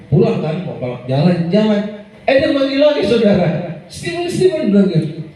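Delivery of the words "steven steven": higher call across the mall; imitating someone calling his name. Woman calls after Steven at the mall, high calling voice.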